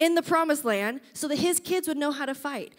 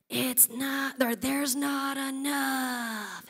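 creepy creature voice; 6 dB quieter, much slower than usual. Retold or imitated. Voicing the poverty creature.

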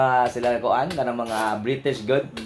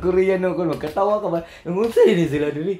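normal talking voice

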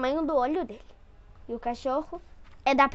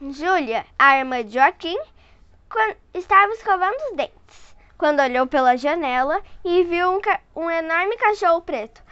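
put-on lively read-aloud voice for a child; storyteller voice for a little one; story narration; 3 semitones higher, 4 dB louder than usual. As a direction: read it like a bedtime picture book. Reading picture book to child.

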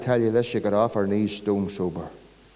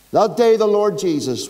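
higher, louder proclamation; fervent preaching; 8 semitones higher, 6 dB louder than usual. Real, not acted. Preacher proclaiming Christ's death to the congregation, fervent. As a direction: ring it out over the hall.

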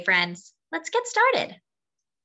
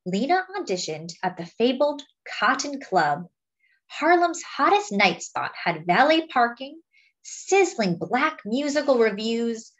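put-on lively picture-book read to children; story narration; 4 semitones higher than usual. A narrator's reading. Reading a Lena Horne picture book to friends, lively storytelling. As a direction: tell it like a storyteller to a class.

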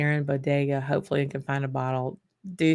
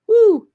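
high, loud whoop of excitement; sudden jump to a very high, loud whoop; excited whoop; 11 dB louder than usual. Real, not acted. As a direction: let out a delighted "woo". Hype over Macallan releases.

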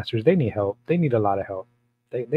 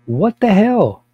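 indignant; 9 semitones higher, 7 dB louder, slower than usual. Real, not acted. Outraged rant at church police.

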